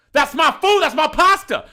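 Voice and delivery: loud, high-pitched mock protest; outraged customer. Customer claiming his nearly empty plate, outraged yelling. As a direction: holler it like an indignant diner.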